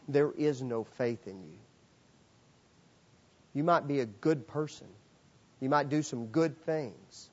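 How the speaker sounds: normal talking voice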